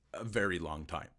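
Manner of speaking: ordinary delivery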